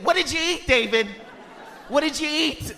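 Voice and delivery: high-pitched insistent questioning; anxious mother. Acted. Mom interrogating son about meal, anxious nagging. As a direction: keep prying, pitched high. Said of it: "She has anxiety".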